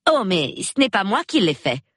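high-pitched, eager denial; hasty correction. A narrator's reading. Young woman denies calling the police, hastily correcting. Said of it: "s'empressa-t-elle de rectifier".

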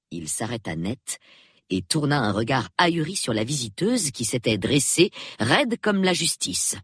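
ordinary talk

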